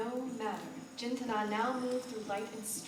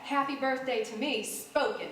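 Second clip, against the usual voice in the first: haughty; 4 semitones higher, 6 dB louder than usual. Acted. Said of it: "Belinda Kite snapped".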